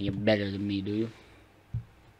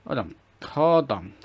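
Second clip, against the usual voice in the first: higher, louder hold on; loud halt; 4 semitones higher, 9 dB louder than usual. Real, not acted. Pausing the game in a hurry, loud halt. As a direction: call for a halt sharply.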